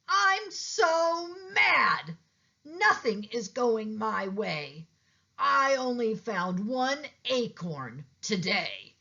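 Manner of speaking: mad squirrel. A narrator's reading. Squirrel complaining to Pete about acorns, in a high huff.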